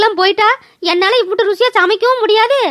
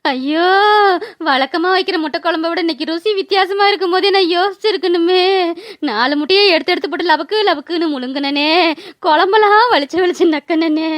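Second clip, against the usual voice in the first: woeful lament; 2 semitones lower than usual. Acted. Aunt rues eating the egg curry, woeful lament. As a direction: bemoan every greedy mouthful.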